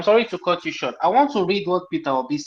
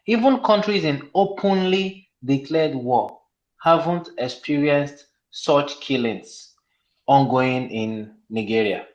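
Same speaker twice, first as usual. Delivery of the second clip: slow, measured quote with "openly" drawn out; stress on openly; 3 semitones lower, slower than usual. Real, not acted. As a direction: read it slowly, weighting "openly". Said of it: "I emphasize on the word openly".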